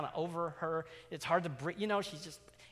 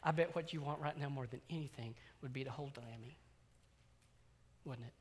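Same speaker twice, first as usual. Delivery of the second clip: soft and quieter, tender; gentle coaxing; 6 dB quieter than usual. Retold or imitated. Dad softly offering his daughter her toy, tender. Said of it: "I look at my little girl".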